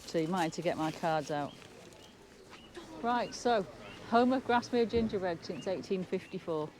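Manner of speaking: normal talking voice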